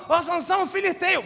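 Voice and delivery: high, loud alarm call to Samson. Acted. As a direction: shout the warning up high. Delilah calls out to Samson. (grito alto de alerta)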